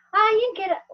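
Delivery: put-on a kid who lost the thread; child who lost track of the story, confused; startled child. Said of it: "Y el niño".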